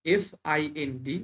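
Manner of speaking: letters drawn out for students; teacher spelling এফআইএনডি slowly. Shown as a demonstration. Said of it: "এখানে খেয়াল করো".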